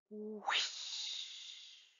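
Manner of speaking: breathy rushing whoosh of a rocket. Acted. Narrator imitating the rocket launch with a whoosh. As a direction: blow it out like air rushing past.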